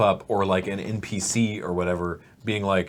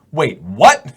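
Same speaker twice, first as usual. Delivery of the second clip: loud, higher startled question; shocked disbelief; 5 semitones higher, 12 dB louder than usual. Acted. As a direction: blurt it out in mock shock. Crawler hears one can walk.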